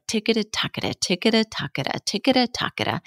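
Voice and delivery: "ticketa-tacketa" repeated crisply and evenly; tongue twister drill. Shown as a demonstration. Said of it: "you can also do things like".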